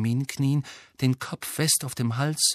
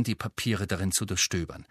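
ordinary delivery, nothing marked

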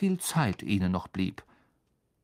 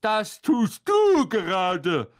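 loud, slow, high retort; admonishing; 9 semitones higher, 5 dB louder, slower than usual. A narrator's reading. Ron turns Percy's warning on him, admonishing. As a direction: throw the rebuke back with emphasis. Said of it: "ermahnte ihn Ron".

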